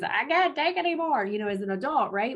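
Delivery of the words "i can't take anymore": mock frustrated scream. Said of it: "you just wanna scream at your kid".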